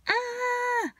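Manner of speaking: very high mock wail; imitated high cry. She cries after being told off, imitated high wail.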